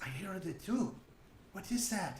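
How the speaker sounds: high-pitched character voice; Irina. Acted. Irina hears the skittering under the floor, high-voiced. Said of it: "So Irina says".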